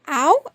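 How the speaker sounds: loud, sudden ow; yelp of pain. Real, not acted. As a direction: yelp at a scratch. Cat clawing her.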